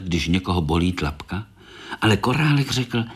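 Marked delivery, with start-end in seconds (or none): none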